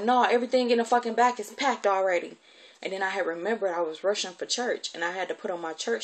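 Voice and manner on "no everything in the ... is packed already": raised, louder annoyed answer; irritated retort. Retold or imitated. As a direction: shut the question down crossly. Guardian snaps back at child.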